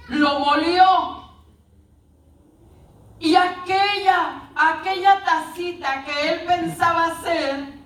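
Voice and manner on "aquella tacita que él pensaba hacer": normal talking voice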